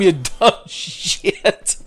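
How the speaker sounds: slow, high, laughing through the words; laughing insult. Real, not acted. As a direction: dissolve into laughter as you say it.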